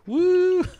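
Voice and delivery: high, loud, drawn-out "woo"; playful whoop. Pretend. Mock cheer for sick viewers.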